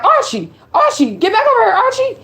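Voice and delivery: high call to a runaway dog; imitating a woman calling her dog back; high-pitched mock call after a pit bull; owner calling her dog. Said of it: "She calling Archie like".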